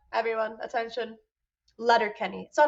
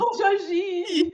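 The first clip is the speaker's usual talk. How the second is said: slow, higher, louder French Bonjour, Gigi; mock French accent; 7 semitones higher, 4 dB louder, slower than usual. Shown as a demonstration. Correcting a friend's French, slow hammed-up Bonjour, Gigi. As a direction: camp it up like a cartoon Parisian. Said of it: "No, no, no".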